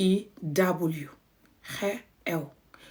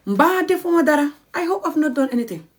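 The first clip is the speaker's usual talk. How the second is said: acted worried caller; 7 semitones higher, faster than usual. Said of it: "Just the word da and then ra".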